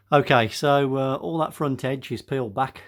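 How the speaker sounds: ordinary talk, nothing marked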